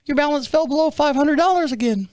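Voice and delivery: put-on high, girlish rep voice; little girl on phone. Bank rep asking caller to verify, high little-girl voice. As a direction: rattle off verification in a girlish pitch. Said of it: "I get this little girl on the phone".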